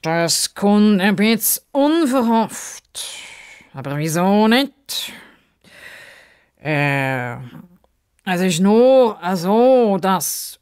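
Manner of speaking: slow, high, stiff gentleman hesitating; slow high formal voice, faltering; hesitant Justus. Acted. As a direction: hem and haw like a prim gentleman.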